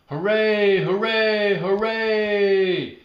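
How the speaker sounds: high, very loud crowd cheer; shouted cheer. Acted. The class cheering Norma Jean, high and very loud. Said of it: "shouted the kids".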